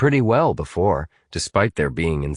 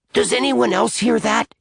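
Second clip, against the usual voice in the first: high, anxious blurt; alarmed kid hearing something. A narrator's reading. Atticus hearing gurgling, blurting high and alarmed. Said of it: "Atticus blurted".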